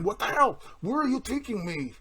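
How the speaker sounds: high, loud panicked shout; panicked yell. Retold or imitated. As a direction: yell in alarm at the stranger. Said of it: "starts yelling at the man".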